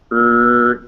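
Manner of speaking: R drawn out as "rrr", air coming out the front; held R sound. Shown as a demonstration. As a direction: sustain R as a pure "rrr" sound. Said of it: "you can feel that the air comes out".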